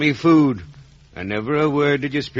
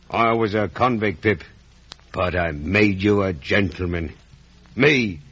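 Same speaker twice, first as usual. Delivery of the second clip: usual speaking voice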